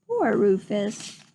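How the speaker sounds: ordinary delivery, nothing marked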